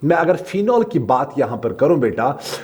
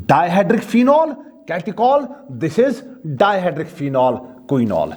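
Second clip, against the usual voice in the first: slow dictation; 3 semitones higher, much slower than usual. Shown as a demonstration. Teacher labels a structure for students, slowly. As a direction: stretch the name out for the notes. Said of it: "teacher dictating labels".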